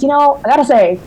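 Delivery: imitating a Newfoundland bayman, Newfoundland accent. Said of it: "I'm doing my accent right now".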